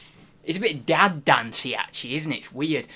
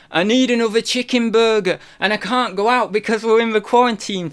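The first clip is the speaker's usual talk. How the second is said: higher-pitched mock complaint; Zahir impression; 6 semitones higher than usual. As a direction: imitate him, pitched up and insistent. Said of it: "the only thing I can do of the here".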